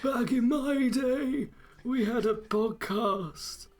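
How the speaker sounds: put-on high, slow creaky old man. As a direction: play a doddering grandpa. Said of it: "I don't know how to do an old person voice".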